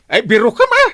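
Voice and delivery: you must be kidding me, said sharply; disbelief. Acted. Captain to his wife, loud disbelieving cry.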